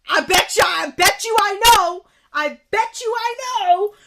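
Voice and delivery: loud, high outburst; indignant shouting. Real, not acted. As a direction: shout it in heated certainty. Furious guess at Brian's ethnicity.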